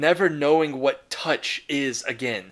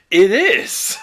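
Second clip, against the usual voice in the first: excited exclamation; 6 semitones higher, 10 dB louder than usual. Real, not acted. Telling viewers the nightmare is real, excited loud burst. Exclaiming after a rhetorical question.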